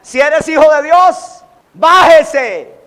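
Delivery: loud mocking challenge; crowd's jeer. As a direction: hurl it like the crowd at the cross. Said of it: "Qué le decían al Señor allá a la multitud". (burla fuerte de la multitud)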